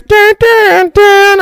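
high, punched-out theme sting; imitated entrance music. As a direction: mimic an ominous fanfare with your voice. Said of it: "And then all you hear is".

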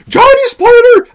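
high, loud mock protest; mock outcry. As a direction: squawk it like an outraged viewer. Mimics viewers objecting to a spoiler. (cri de protestation aigu, pour rire)

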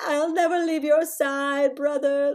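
high, slow, drawn-out mock sob; imitated crying child. Mother imitating Rollins on the way home, crying. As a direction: wail devotion like a weepy child. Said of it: "they both start crying again".

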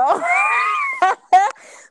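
sudden loud high laugh bursting out; loud burst of laughter. Real, not acted. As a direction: break into a loud shrieking laugh. Burst of laughter about brother.

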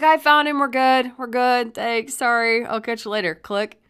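loud and high, easing toward the sign-off; retold hurried phone call, starting at a shout; urgent relief. Parent wrapping up the call with Sully, loud urgent relief.

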